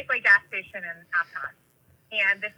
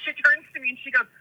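ordinary delivery

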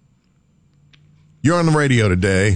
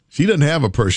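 normal talking voice

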